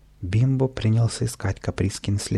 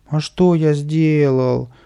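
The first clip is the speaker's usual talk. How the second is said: slow, higher whiny whimper; 4 semitones higher, 4 dB louder, much slower than usual. Acted. Kapriska whimpering to the crowd, slow whiny plea. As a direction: whine it like a wronged child. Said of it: "хныкал Каприска".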